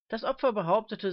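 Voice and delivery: ordinary delivery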